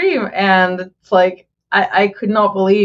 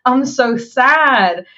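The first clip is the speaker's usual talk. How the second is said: lightly voiced teen, higher, mock-sad; mock sad whine; 6 semitones higher than usual. Retelling a fan's credits reaction, mock sad. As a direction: play the sad fan not wanting it over. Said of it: "first she starts with".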